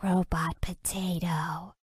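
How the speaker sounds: soft whispered nonsense; whispering. Acted. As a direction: breathe it into her ear. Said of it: "whispered some nonsense in his girlfriend's ear".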